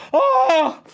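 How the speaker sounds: very high, loud drawn-out "oh"; excited squeal. Acted. Streamer celebrates campaign milestone, excited squeal. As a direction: squeal in the goofy character voice.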